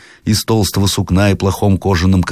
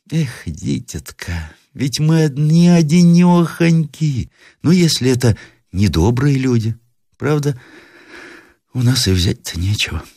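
worried grandmother; 9 semitones higher than usual. A narrator's reading. Grandmother fears bad people at the gate, worried. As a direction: fret aloud like an old granny.

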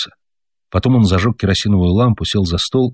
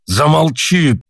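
shout; 7 semitones higher, much slower than usual. A narrator's reading. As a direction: bark it out to silence her. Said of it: "закричал отец на мать".

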